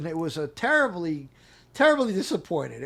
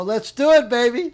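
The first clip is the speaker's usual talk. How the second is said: put-on loud, gung-ho "let's do it, baby"; fired up and ready to go; hammed-up enthusiasm; excited; 5 semitones higher, 9 dB louder than usual. Retelling his eager reply.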